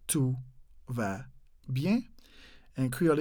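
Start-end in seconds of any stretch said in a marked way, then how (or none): 0.1-2.0 "Tout va bien" with long gaps between words. Shown as a demonstration. Teacher spacing out the French for learners. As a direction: isolate each word, lifting "bien" at the end. Second slow repeat for learners.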